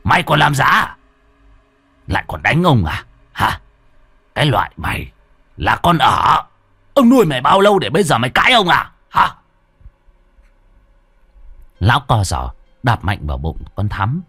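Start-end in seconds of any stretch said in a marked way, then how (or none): 0.0-9.3 louder, faster angry tirade building to a roar; furious shouting. Acted. Old Khâm yelling at the servant girl who kicked him, furious shout. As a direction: berate her, rising to a fast shout. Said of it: "Lão hét vào mặt con Thắm".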